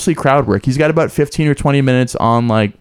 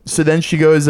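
ordinary talk

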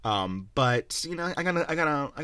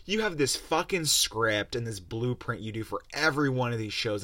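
normal talking voice

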